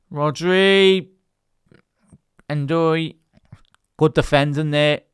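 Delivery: loud, high rising call of the names; sudden burst of live commentary; excited shout. Real, not acted. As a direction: call the action as it happens, roaring. Live match call, big chance.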